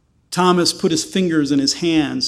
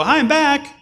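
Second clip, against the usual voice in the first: much higher, breezy mock hello; casual mock greeting; 9 semitones higher than usual. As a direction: toss it off like a flippant comeback. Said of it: "stand in the middle of the temple and go".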